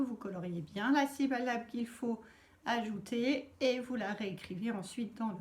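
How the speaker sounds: ordinary talk, nothing marked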